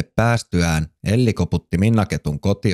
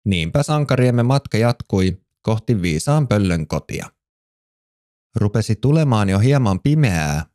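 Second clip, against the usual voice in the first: plain reading voice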